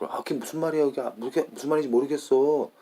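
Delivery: rapid, high-pitched native Korean lilt; Korean people's intonation. Shown as a demonstration. Speaker showing how everybody in Korea talks, quick Korean intonation. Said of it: "That's how Korean, that everybody talks".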